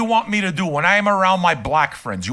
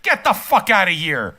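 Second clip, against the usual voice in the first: ordinary delivery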